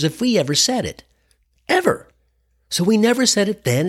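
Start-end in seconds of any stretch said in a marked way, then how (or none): 1.7-2.1 high sharp stab on one word; emphatic. Retold or imitated. Comic telling his audience, emphatic. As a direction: hit it like a stern parent's warning. Emphatic repeat of parents' rule.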